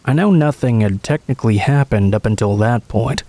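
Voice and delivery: ordinary delivery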